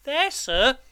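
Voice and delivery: put-on high, anxious child's voice; timid. A narrator's reading. Boy points out mother's grave to convict, timid. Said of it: "I timidly explained".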